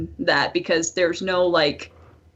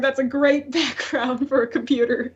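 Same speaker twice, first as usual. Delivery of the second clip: usual speaking voice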